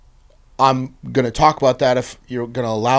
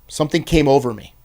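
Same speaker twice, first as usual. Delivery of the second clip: normal talking voice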